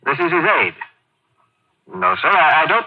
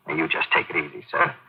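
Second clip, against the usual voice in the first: softer and lower, gently calming; soothing; 8 semitones lower, 7 dB quieter than usual. Acted. Aide calming the shaken colonel, soft and soothing. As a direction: settle him down like a patient.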